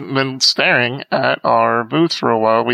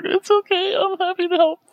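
choked up. Retold or imitated. Thanked by a crying father, answering choked up. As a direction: voice cracking high through tears. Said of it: "falling out of my wheelchair".

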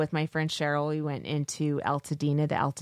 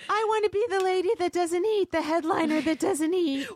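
prissy lady voice; 12 semitones higher than usual. Retold or imitated. Mock dainty lady voice.

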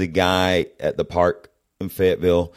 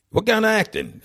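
southern guy asking; 13 semitones higher than usual. Retold or imitated. Southern guy voiced in story.

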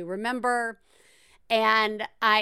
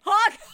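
silly honk; 11 semitones higher, 7 dB louder than usual. Pretend. Imitating a car horn.

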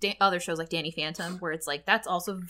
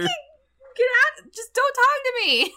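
much higher, louder laughing brush-off; laughing rebuff; 4 dB louder, much slower than usual. Retold or imitated. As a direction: push him away through laughs. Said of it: "acted rebuff continued".

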